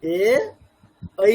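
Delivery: isolated এ held steady, then ঐ gliding from a to i; clear, distinct vowels. Shown as a demonstration. Teacher repeating the model for learners, এ and ঐ said distinctly. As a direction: give এ and ঐ each a full, separate sound. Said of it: "এরকম, মানে স্পষ্ট বলতে হবে".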